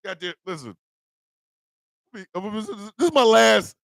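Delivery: ordinary delivery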